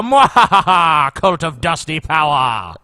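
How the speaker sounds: slow evil mwahaha; gloating villain cackle, drawn out; sneering panto villain. Retold or imitated. Evil laugh parody of Dusty.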